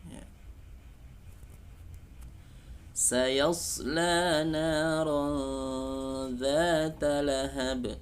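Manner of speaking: slow, high chanted recitation; Quran recitation. Shown as a demonstration. Teacher recites the verse for students.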